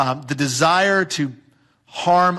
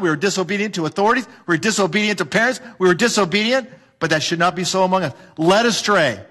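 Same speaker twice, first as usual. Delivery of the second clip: usual speaking voice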